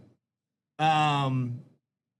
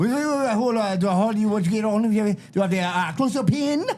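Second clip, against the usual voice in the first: hurried mock French accent, higher; imitating the French guy over the phone, pitched up; 7 semitones higher than usual. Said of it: "the guy who runs the course is French".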